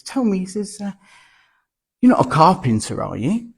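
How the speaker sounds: teasing, knowing question; storyteller's Jesus leading to the punchline. Acted. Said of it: "looks back at the man and he says".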